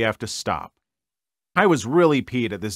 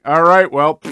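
louder, higher flippant goodbye; breezy brush-off; 5 semitones higher, 6 dB louder than usual. Retold or imitated. The sister dismissing her family with a flippant brush-off. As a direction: toss it off and walk away. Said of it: "in the end being like".

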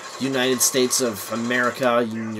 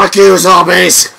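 loud, higher, drawn-out shout; yelling at enemies; 5 semitones higher, 12 dB louder than usual. Real, not acted. Player attacking zombies in game, defiant shout.

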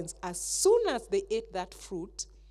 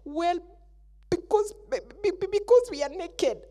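frightened stammer; 8 semitones higher, 5 dB louder than usual. Acted. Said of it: "how afraid he was".

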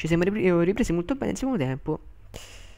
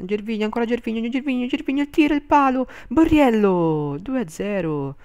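breathless goal commentary; 8 semitones higher, 5 dB louder than usual. Real, not acted. Building goal call.

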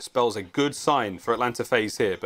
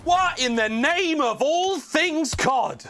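hype esports call; slower than usual. Real, not acted. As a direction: build the hype to a scream. Caster hype during a wipe.

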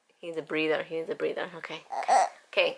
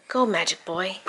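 usual speaking voice

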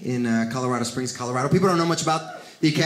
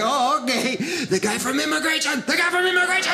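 much higher, rising panic call; mock alarmed warning; 9 semitones higher than usual. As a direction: warn the kitchen in mock fear. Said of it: "they're like".

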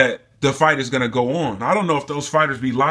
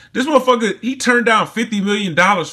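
angry outburst; 6 semitones higher, 3 dB louder than usual. Real, not acted. Ranting about McGregor's lies.

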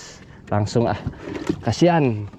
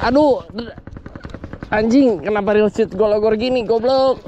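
high, fast frustrated cursing; frustrated swearing; 9 semitones higher than usual. Real, not acted. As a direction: curse at the lost fish. Fish lost, swearing.

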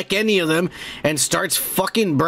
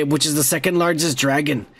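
ordinary talk

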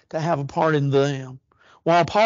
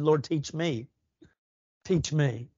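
humble quiet plea; 6 dB quieter, slower than usual. Acted. Quoting the Bereans' prayer.

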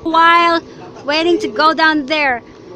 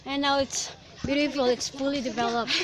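normal talking voice

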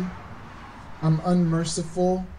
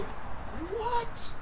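very high, softer mock-outraged squeak; imitated indignant disbelief; 9 dB quieter than usual. Angry older brother hearing of the party, incredulous. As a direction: draw it out high in incredulous protest. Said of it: "He was angry. He was like".

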